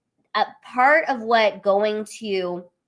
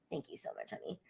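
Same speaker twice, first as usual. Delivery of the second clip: whispered aside; 22 dB quieter, faster than usual. Real, not acted. Aside to someone off mic.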